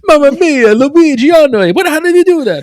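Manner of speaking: high, loud cartoon Italian astonishment; hammed-up Italian accent. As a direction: wail in mock Italian disbelief. Plays an amazed Italian reactor.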